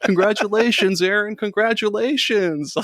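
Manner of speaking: high mock-screamed congratulations; screaming congratulations. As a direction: wail it like an anime finale. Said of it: "just screaming".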